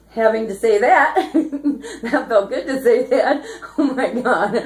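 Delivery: laughing through the words, giggly and light; giggling. Real, not acted. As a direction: giggle as you say it, tickled with yourself. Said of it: "I'm giggling and laughing".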